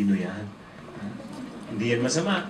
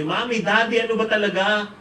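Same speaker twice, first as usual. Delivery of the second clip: put-on higher, louder child's complaint; exasperated child; 5 semitones higher, 7 dB louder, faster than usual. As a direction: pile up grievances at Mom and Dad, building louder. Child confronts always-angry parents. (nagrereklamong anak, palakas nang palakas)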